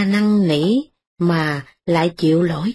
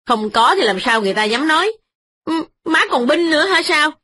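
put-on quick, higher young wife snapping back; sharp wife's retort; 8 semitones higher, faster than usual. Wife snaps back at her mother-in-law, quick and high. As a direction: fire it back fast and high.

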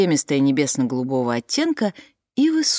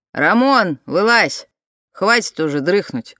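calling out; 2 semitones higher, 4 dB louder than usual. Acted. Woman calls into tent.

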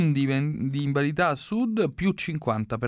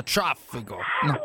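Sicilian accent; slower than usual. Shown as a demonstration. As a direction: show off the Sicilian Traffico with its rolled tr. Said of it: "Me lo dica con quel bel accento siciliano".

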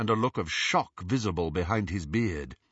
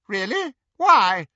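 haughty Bellatrix; 12 semitones higher, 9 dB louder than usual. A narrator's reading. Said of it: "asked Hermione".

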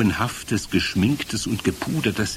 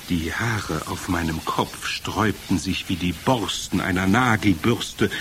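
ordinary delivery

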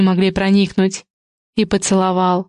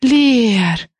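drawn-out exhale. A narrator's reading. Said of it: "протяжная на выдохе".

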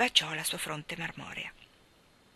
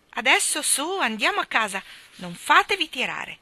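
loud, higher-pitched governess order; brisk command; 6 semitones higher, 7 dB louder than usual. A narrator's reading. Mary Poppins ordering the children home, brisk command. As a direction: chivvy the children along, raised voice.

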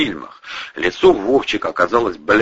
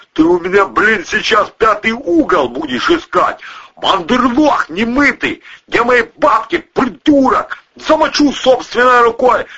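high, loud angry tirade; furious threatening; 14 semitones higher, 4 dB louder than usual. A narrator's reading. Boss threatening his pal over lost money, furious. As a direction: rant threats at full pitch.

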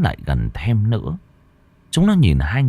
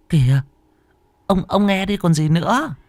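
put-on higher, nervous old woman; anxious old wife urging; 10 semitones higher than usual. Frightened wife urging her husband to answer the phone. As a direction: push him to answer, uneasy.

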